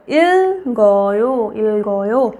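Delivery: इल्गोयो drawn out as il, go, yo; jumped consonant. Shown as a demonstration. Teacher showing where the sound moves, इल्गोयो slowly split after the l. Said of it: "तो इसको हम पढ़ेंगे".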